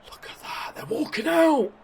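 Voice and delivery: high, loud burst of excitement; excited exclamation. Real, not acted. Gamer watching the teams walk out, high excited cry. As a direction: cry out in delight at the sight.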